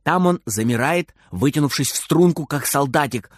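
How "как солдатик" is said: plain reading voice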